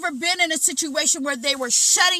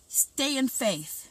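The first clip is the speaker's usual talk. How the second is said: usual speaking voice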